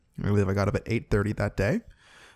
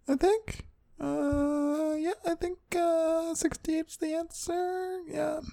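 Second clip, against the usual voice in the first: very high, soft, drawn-out falsetto; falsetto musing; 7 dB quieter, much slower than usual. Pretend. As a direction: think aloud in a silly tiny high voice. Comic musing over episode count.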